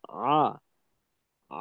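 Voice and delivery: আ with light, moderate throat pressure; a gentle, balanced Ain in আ; correct Ain demo. Shown as a demonstration. Teacher showing students the right Ain, lightly pressed. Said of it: "আমার করা উচিত ছিল এভাবে".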